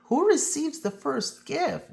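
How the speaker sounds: put-on a touch of eager kids; children asking. A narrator's reading. Children ask the conductor about the gift, mildly eager. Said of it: "we all asked".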